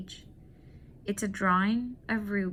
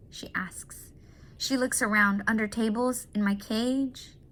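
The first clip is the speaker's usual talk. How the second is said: ordinary delivery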